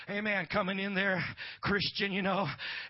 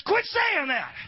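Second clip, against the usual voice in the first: high, loud scolding; fed-up outburst; 12 semitones higher, 11 dB louder, much slower than usual. Retold or imitated. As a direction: act out a woman who has had enough. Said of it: "Finally, she had enough".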